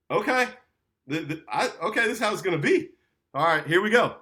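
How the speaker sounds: high, quick psyching himself up; bracing himself. Retold or imitated. Traveller in the chaos psyching himself up, high and quick.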